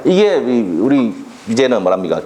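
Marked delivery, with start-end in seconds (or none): none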